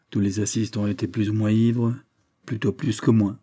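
ordinary talk

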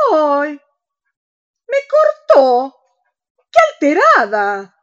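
high loud shocked exclaim; outraged, she hung up; high shrill outrage at the hang-up; indignant surprise. Acted. Friend hung up on her.

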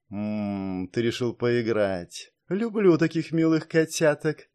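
lightly voiced seductive killer; sultry purr. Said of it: "Её голос ушёл на шёпот".

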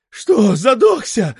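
shout. A narrator's reading. The friend shouting at choking Lokhov. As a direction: shout the question. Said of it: "кричит его кум".

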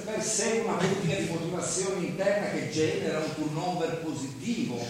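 ordinary talk

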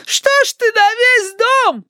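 put-on piercingly high, slow old-woman voice; high granny whine; querulous grandma. A narrator's reading. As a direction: complain in a drawn-out, very high granny voice. Grandmother protests at shouting.

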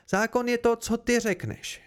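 high-pitched, insistent interruption; cutting in. A narrator's reading. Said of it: "skočila mu do řeči". (skočit do řeči, důrazně a vysoko)

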